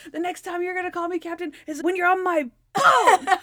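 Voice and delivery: high, loud gloating cry; triumphant crowing. Retold or imitated. Captain tells off his colleagues after winning, triumphant crowing. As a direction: crow it as the imitated winner, building to a shout.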